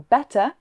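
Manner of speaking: "better" ending in a plain vowel, no R sound; non-rhotic English "better", R dropped at the end; silent final R. Shown as a demonstration. Teacher explains their English accent, "better" with silent final R. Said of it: "we don't pronounce the R at the end".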